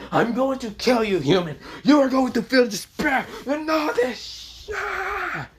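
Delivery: high-pitched villain rant rising into a scream; angry screaming. Acted. Vector exploding with power at the human, angry high screaming. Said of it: "getting angry, saying".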